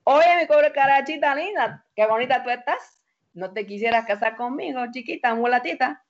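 a hint of a Cuban wolf's accent. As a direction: charm her in a Cuban accent. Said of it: "traía un tono cubano increíble".

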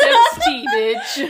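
loud, high victory yell; triumphant shout. Real, not acted. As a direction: crow about the score.

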